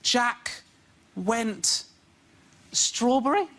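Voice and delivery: halting, word-by-word child reading, lightly voiced; mock child sounding out words; imitating son reading. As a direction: sound out each word like a struggling young reader. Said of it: "when my son goes".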